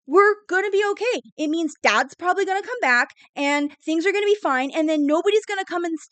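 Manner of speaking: high, loud, tumbling over her words; a little girl sure everything is fine now; excited child. Acted. Jenny insisting to her mother that Dad will come back, excitedly.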